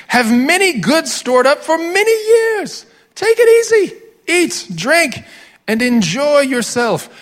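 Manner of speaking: high, loud, slow hammed-up British snob; mock British accent savouring every word. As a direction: play the greedy British toff. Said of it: "distinctly British".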